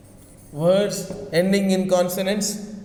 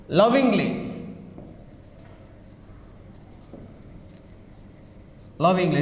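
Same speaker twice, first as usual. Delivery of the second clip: "Lovingly" with the stress on the first syllable, LOV-ingly; 4 dB quieter, faster than usual. Shown as a demonstration. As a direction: lean on LOV in "Lovingly", let the rest fall. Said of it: "The stress is on first syllables".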